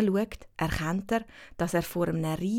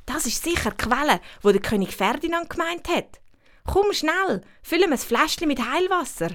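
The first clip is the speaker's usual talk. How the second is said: put-on much higher, eager young girl; excited girl voice; 9 semitones higher than usual. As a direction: hurry a friend along excitedly. Winnie voiced in story.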